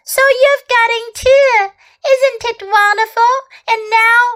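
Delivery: Lucy gushing to Edmund about Narnia; excited girl. A narrator's reading. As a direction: bubble over with wonder.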